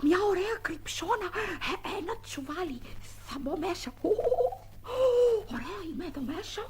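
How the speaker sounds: softly pleased, hidden in the sack; hushed. Acted. Child hiding in a sack, whispering to himself. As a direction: keep it low so no one hears.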